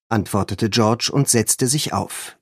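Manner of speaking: ordinary delivery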